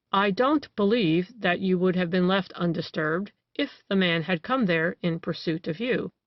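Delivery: ordinary delivery, nothing marked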